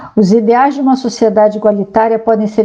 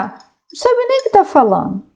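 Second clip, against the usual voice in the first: much higher-pitched repeat of the dismissal; exasperated dismissal; 11 semitones higher than usual. Real, not acted. Speaker dismissing people who attack communism, exasperated. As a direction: throw it out high, in exasperation.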